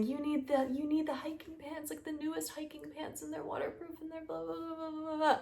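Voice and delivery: high, hushed monotone prattle fading into blahs; mocking parroting. Mimicking everyone pushing the newest hiking pants, mocking prattle. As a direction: parrot a sales pitch dismissively, high and soft. Mocking what advertisers keep saying.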